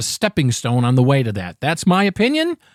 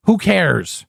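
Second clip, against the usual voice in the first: normal talking voice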